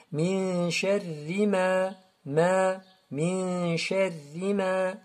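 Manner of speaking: "min" held in a long hum, "ma" drawn out; slow, sustained "min sharri ma"; model recitation. Shown as a demonstration. Teacher models for students, slow recited "min sharri ma".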